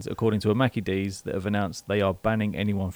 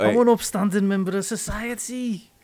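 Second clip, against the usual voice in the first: posh-ish lad protest; 9 semitones higher than usual. Pretend. Lad claiming innocence.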